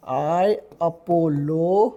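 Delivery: very slow, broken into syllables; teacher sounding out the word for students, slowly; slow syllable drill. Shown as a demonstration. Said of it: "I apologize म्हणजे माफ करा".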